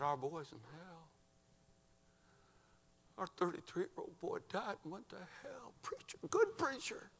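soft, high, anguished voice; acting a bereaved mother near breaking; grieving mother. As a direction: rise from hushed grief to a thin, high whisper. Grieving mother voiced in sermon story.

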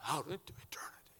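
high, breathless trailing whisper; scream fading away. Retold or imitated. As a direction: let the cry die into a whisper. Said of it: "our boy screaming".

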